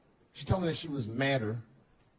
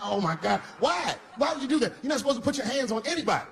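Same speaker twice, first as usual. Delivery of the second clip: put-on father stunned his son hit a girl, high alarmed scolding; shocked scolding; 11 semitones higher than usual. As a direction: scold in disbelief, pitched up high.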